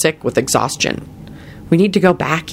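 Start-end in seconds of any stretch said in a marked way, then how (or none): none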